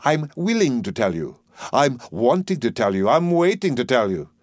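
professor echoing a lilting phrase; mock the singsong rhetoric in careful diction; imitating the dustman's rhythm. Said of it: "Observe the rhythm of his native wood notes wild".

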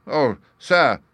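high, loud exclamation; emotional cry. A narrator's reading. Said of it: "he cried".

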